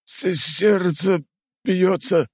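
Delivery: high, slow stammering gasp; breathless stammer. Acted. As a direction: stammer out in shock. Old man overwhelmed, stammering.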